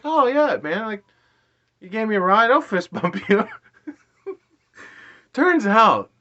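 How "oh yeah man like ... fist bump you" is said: high-pitched, upbeat self-quote; eager acted reply. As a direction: act out an over-eager yes, voice jumping up. Acts out his eager reply to driver.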